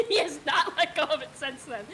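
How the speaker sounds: laughing. Real, not acted. Laughing complaint about friend's bit.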